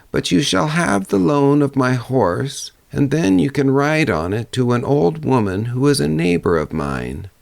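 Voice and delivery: put-on an old woman giving directions, pitched high. Old woman tells the girl the way, in a high old voice.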